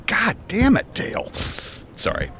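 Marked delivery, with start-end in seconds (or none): 0.1-1.3 frustrated curse. Acted. Sweat-soaked shirt frustration.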